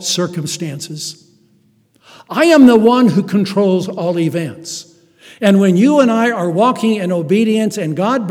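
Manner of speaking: loud, high preaching build; full-force sermon climax; fiery preaching. Acted. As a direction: preach it with rising fire.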